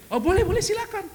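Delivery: a mock doctor granting permission, airy and high; doctor's reply. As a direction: brush it off lightly like the doctor did. Doctor's reply voiced in the story.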